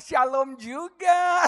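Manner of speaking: slow, high, drawn-out reply; sweetened greeting. Retold or imitated. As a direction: stretch the greeting out with exaggerated friendliness. Acts his sweetened reply to greeting. (salam balasan bernada tinggi, dipanjang-panjangkan)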